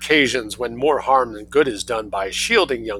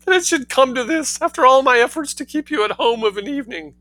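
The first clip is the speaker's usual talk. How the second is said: high, wailing sob; sobbing; 13 semitones higher, 3 dB louder than usual. Acted. Mother lamenting to her son, hammed-up sobbing. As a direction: wail it like a wronged mother. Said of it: "sobbed Missus Heasant".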